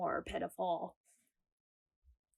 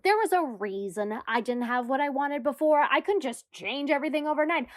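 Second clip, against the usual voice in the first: ordinary talk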